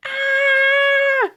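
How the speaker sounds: put-on shrill startled girl scream; high scream. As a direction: shriek in terror. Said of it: "she screamed again".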